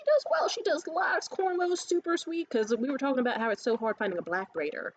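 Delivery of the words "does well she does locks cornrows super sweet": high, gushing praise. Retold or imitated. Recommending her braider to the woman, high gushing. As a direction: sell the braider with delight.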